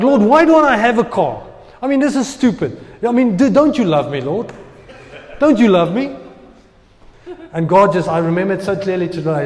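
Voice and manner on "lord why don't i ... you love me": higher, louder pleading complaint; complaining to God. Retold or imitated. Young minister demanding a car from God, louder and higher. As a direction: whine up at heaven, hurt and indignant. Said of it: "It's like".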